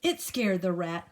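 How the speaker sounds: ordinary delivery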